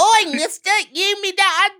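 high, loud British squawk; British meme-doll accent. Acted. Host redoing the meme quote properly, loud high British accent. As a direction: shout it as a squeaky British doll. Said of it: "that's a meme of a, a doll".

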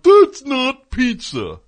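high, slow, loud sneering complainer; hammed-up indignant pizza snob; mock pizza snob. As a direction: drag it out like an outraged pizza purist. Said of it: "I like when there's people like".

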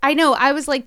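ordinary talk

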